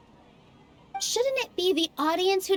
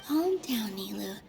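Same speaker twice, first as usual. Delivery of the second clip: softer, murmured thoughts to herself; quiet inner voice; 4 semitones lower, 6 dB quieter than usual. Acted. Nilou calming herself mid-debate, murmured inner voice. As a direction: talk it over under your breath.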